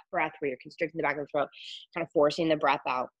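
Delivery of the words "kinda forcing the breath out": normal talking voice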